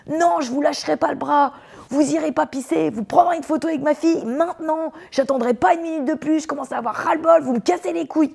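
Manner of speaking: high, raging father refusing to let go; furious dad. Retold or imitated. Angry father holding her arm, refusing to let her go. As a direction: snap it out, fed up and furious. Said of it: "Il me parlait comme ça".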